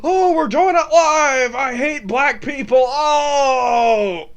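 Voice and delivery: high, shouted mock climax; Bill O'Reilly. Comedian spoofs a TV host climaxing, Bill O'Reilly impression. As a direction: wail loudly between drawn-out outbursts. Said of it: "All right, that's my Bill O'Reilly".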